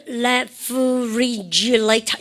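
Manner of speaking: "refrigerator" drawn out slowly, each syllable separate. Shown as a demonstration. Teacher writing the word for students, syllable by syllable. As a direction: spell out "refrigerator" by sound, very slowly.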